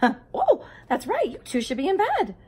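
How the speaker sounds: a playful mom in a picture book; mom's voice. A narrator's reading. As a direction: play the mom shooing the twins to bed. Mom's line read in story.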